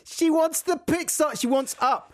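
high, insistent explaining; high-pitched, worked-up insistence; mock exasperation. Co-host defending his joke, exasperated.

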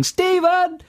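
high, stretched name call; mock catchphrase shout. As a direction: cry out the name in mock alarm. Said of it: "without shouting, or at least thinking".

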